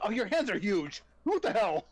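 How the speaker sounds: a player voicing a shocked NPC, hammed-up surprise; mock shock. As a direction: gasp at the huge hands in pretend alarm. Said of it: "It's like".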